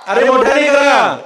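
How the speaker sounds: loud, drawn-out call to the crowd; rousing shout. Real, not acted. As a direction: urge the whole room to shout back. Urging the audience to answer louder.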